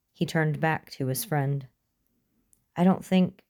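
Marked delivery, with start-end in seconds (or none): none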